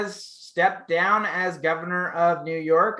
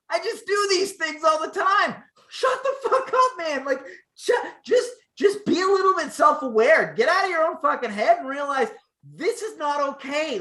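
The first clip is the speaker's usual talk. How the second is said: mock defiant governor; 9 semitones higher than usual. To cohost, mocking the governor's defense, high and hammed-up. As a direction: whine it high and indignant, hammed-up.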